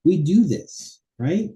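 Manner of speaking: ordinary talk, nothing marked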